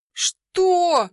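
sudden high yelp of disbelief; high startled shout; shouted what. A narrator's reading. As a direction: shout it in shock. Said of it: "ору".

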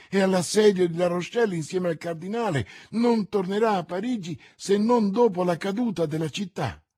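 loud, high, quickening protest; vehement objection. Acted. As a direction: argue back forcefully in disbelief. Milady objects in disbelief.

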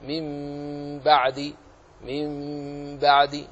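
من بعد as a long hummed mim-ba'd; iqlab recitation. Shown as a demonstration. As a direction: hold the m of من بعد very slowly before the b. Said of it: "فتقرأ هكذا".

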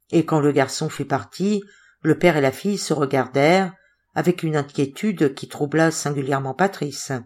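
plain reading voice

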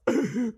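a short bright chuckle; light chuckle. A narrator's reading. Narrator chuckling before Geppetto muses. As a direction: chuckle to yourself.

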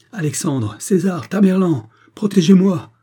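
high, loud cries building to a frantic plea; desperate pleading. A narrator's reading. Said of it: "supplia Harry Madge".

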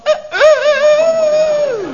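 very high, loud, slow drawn-out crowing; mock rooster crow. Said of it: "roept hij een paar keer achter elkaar iets van". (nagedaan gekraai van een jonge haan)

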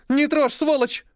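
high, loud desperate cry; tearful scream. Acted. Irène screaming at the attacker, desperate. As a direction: scream him off.

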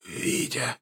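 voiceless, faint rasp; wounded old man's wheeze; hoarse wheeze. A narrator's reading. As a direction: croak the name out with barely any breath. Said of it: "прохрипел он".

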